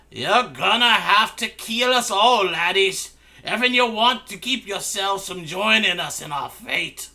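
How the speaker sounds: dwarven assassin. Acted. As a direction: bellow it like a brawling dwarf. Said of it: "The Dwarven Assassin says".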